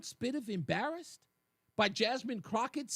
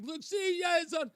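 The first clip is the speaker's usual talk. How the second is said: gloating cheer; 8 semitones higher than usual. Retold or imitated. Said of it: "You're like".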